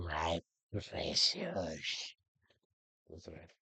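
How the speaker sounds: slow, low Gollum hiss; slow, low creepy creature voice. Pretend. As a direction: croon it like Gollum. Gollum quote.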